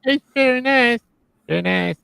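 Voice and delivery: high put-on character voice; hammed-up high-pitched approval; put-on catchphrase voice. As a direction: praise it in a high comic voice. Joins in mock gushing.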